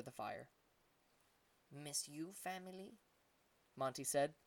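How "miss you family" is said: soft little creature voice, higher rising question; a small monkey asking timidly, much softer; shy monkey question. A narrator's reading. Monkey asks shyly.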